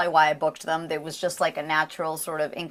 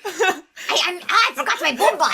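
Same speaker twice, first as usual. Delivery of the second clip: higher, frantic Brazilian Raze; panicked Raze; 10 semitones higher, 3 dB louder than usual. Acted. Actor voices Raze panicking about a missed flight. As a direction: late for the flight, scrambling.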